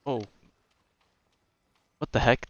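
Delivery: usual speaking voice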